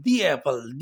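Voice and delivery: "the apple" with a slight y sound, "dee-yapple"; y-glide "the apple". Shown as a demonstration. Teacher models the y glide between the words. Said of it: "وائی جو ہے اس کے درمیان میں پڑھا جا رہا ہے".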